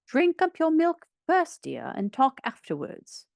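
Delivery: put-on gently instructing a small child; motherly instruction. A narrator's reading. Mother telling her little one to drink milk, motherly.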